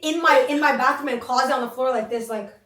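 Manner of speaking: normal talking voice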